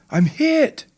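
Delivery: loud, high-pitched battlefield yell. Retold or imitated. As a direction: yell it across the helicopter to a buddy. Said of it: "and yelling".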